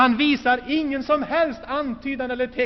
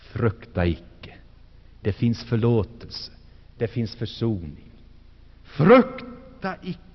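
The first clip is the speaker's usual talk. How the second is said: calming words; 11 semitones lower than usual. Acted. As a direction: lay each word down gently, like a hand on a shoulder. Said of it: "de lugnande orden".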